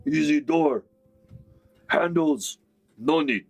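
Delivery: high, dopey orc boast; goofy high orc bragging. Acted. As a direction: brag like a dim orc who just broke in. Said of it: "And he's like".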